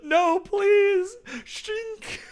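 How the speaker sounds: high, hammed-up cry for mercy then blade sound; mock victim plea. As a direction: play the doomed man squealing. Acting out the decapitation.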